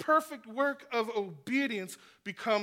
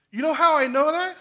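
emphatic; 6 semitones higher, 12 dB louder, faster than usual. Real, not acted. Preacher breaks in with emphasis.

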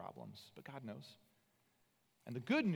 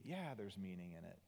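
soft, tender reassurance; God softly comforting a grieving Moses; 3 semitones lower, 6 dB quieter than usual. Acted. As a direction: hush down and comfort him gently. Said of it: "And God says to him tenderly".

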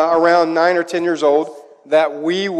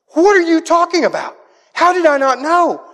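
put-on much higher and louder, indignant little girl; a stunned daughter who feels left out; outraged child; 12 semitones higher, 4 dB louder than usual. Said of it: "She goes".

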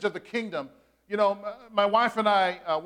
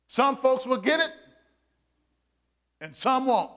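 raised, emphatic sermon delivery with long pauses; preaching; 5 semitones higher, 4 dB louder than usual. Acted. Pastor preaching to his congregation, emphatic and raised. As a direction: call out to the congregation, drawing them in.

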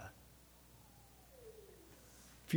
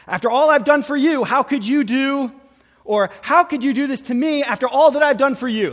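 indignant complaint; 9 semitones higher, 9 dB louder than usual. Retold or imitated. Mocking self-righteous complaint.

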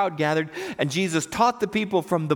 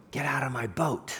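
hushed, low scared retelling; 5 semitones lower, 7 dB quieter than usual. Retold or imitated. Peter at Jesus' knees among the fish, quietly scared. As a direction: say it small and soft, as if frightened. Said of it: "'cause he's scared".

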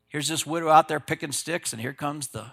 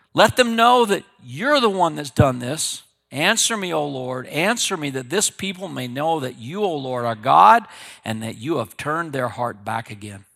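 loud earnest prayer; fervent prayer; 7 dB louder than usual. Acted. Elijah calling on God at the altar, fervent prayer. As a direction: pray out with conviction.

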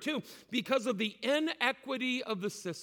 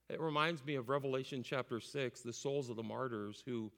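usual speaking voice

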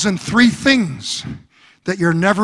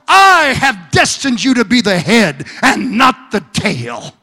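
preaching at full force; 2 semitones higher, 3 dB louder than usual. Acted. Preacher speaking God's promise to the church, full-force preaching. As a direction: proclaim it over the congregation.

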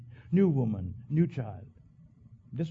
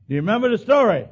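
higher, louder repeated question; 6 semitones higher, 8 dB louder, much faster than usual. Real, not acted. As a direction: push the congregation for an answer. Asks congregation twice.

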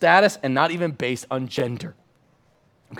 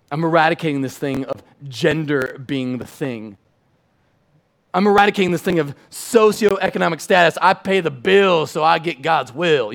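repeated I'm eradicating growing louder; building declaration; 4 dB louder than usual. Retold or imitated. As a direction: build each eradicating line. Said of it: "by saying".

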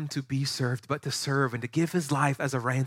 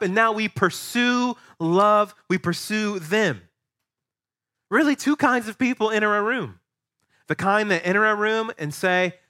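ordinary talk

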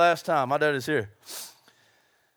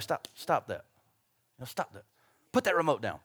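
soft parental scolding; 8 semitones lower, 6 dB quieter than usual. Retold or imitated. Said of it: "It's like".

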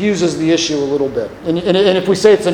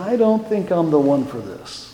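soft, reluctant suggestion, sinking lower; self-doubting deflection; 4 semitones lower, 3 dB quieter than usual. Acted. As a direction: quietly pass the job on to someone else. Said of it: "and you say".

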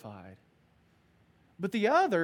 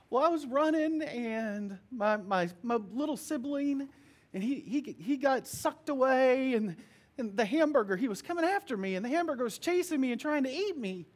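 put-on higher excited child voice; little girl; 7 semitones higher than usual. Daughter telling her father about the nightmare, high and childlike. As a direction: tumble out the dream like a breathless toddler.

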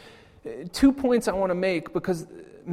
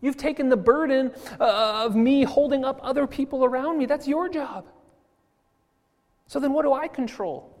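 relieved wonder; 8 semitones higher, 4 dB louder than usual. Retold or imitated. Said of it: "I say".

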